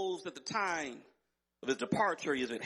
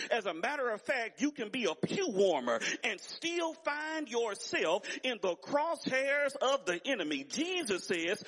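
raised, driving pulpit delivery; fervent sermon cadence climbing higher; impassioned preaching; 5 semitones higher than usual. Real, not acted. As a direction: build in pitch as you warn the congregation. Preaches with rising force.

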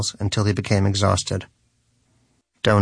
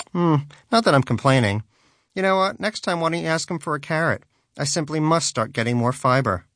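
higher, breezy and mocking; airy mocking tone; 6 semitones higher than usual. A narrator's reading. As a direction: tease him lightly. Danish voiced mockingly.

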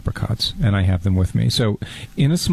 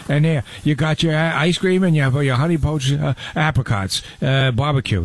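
barbecue guy impression; 3 semitones higher than usual. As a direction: play a folksy grill-master, lightly. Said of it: "my barbecue guy impression".

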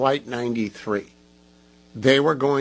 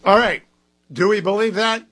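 high, loud disbelief; booming incredulous host; loud skeptical reaction; 8 semitones higher, 6 dB louder than usual. Real, not acted. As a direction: challenge the audience loudly. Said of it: "host reacts to clip".

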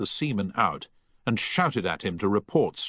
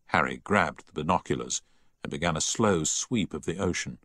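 ordinary delivery, nothing marked

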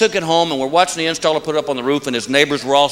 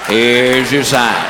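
very slow, low, dragged catchphrase; deadpan punchline; 6 semitones lower, much slower than usual. Acted. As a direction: stretch each word to milk the laugh.

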